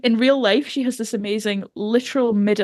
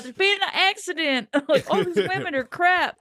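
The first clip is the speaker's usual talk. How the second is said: mock southern Maggie, higher; 9 semitones higher than usual. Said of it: "She's like".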